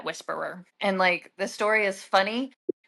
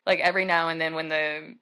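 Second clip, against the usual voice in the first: normal talking voice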